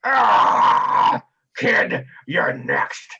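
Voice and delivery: loud cry then slow raspy threat; groan, then growl it slowly; spiked through the chin, slow menacing jeer; pained threat. Acted. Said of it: "he's like".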